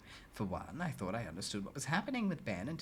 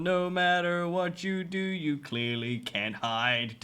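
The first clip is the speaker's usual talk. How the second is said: slow taunt; 5 semitones higher, 9 dB louder, slower than usual. Pretend. Taunting hidden creepy character.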